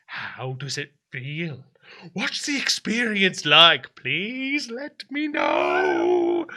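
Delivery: slow, drawn-out plea; pleading Daniel figure. Acted. Daniel begs the onlooker to describe it, slow drawn-out plea. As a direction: stretch the words out, begging to be told. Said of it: "looks at you and says".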